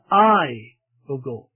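"I" long and strong, then "will go" falls away low; stress on I. Shown as a demonstration. Preacher quoting the bride's own decision, stress on "I". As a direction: put the weight on "I", slowly, and drop the rest. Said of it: "laying the emphasis on the personal pronoun I".

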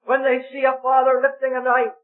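high, impassioned preaching building to a cry at Isaac; full-force preaching. Acted. Preacher telling the meeting of God's gift, impassioned. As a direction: pour out the wonder of the sacrifice.

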